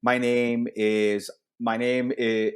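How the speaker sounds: usual speaking voice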